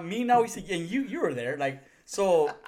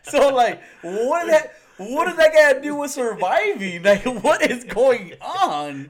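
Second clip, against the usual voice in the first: laughing disbelief; 8 semitones higher, 10 dB louder, slower than usual. Real, not acted. Laughing outrage at lotion.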